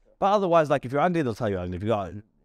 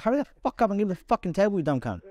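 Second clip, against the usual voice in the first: higher, faster, rude retold outburst; imitating yourself insulting the waitress; mock rude snap; 7 semitones higher than usual. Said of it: "I'm like".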